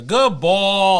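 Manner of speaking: drawn-out Boston "ball"; Boston accent. Retold or imitated. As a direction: say it like a Boston baseball fan, Boston accent. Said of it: "Jerry's the baseball guy".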